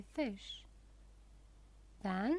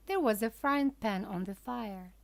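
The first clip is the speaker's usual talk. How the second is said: ordinary delivery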